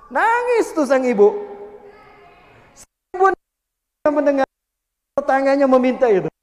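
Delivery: loud, emphatic preaching; loud proclaiming. Real, not acted. Preacher tells the congregation, loud preaching. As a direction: hammer the moment home.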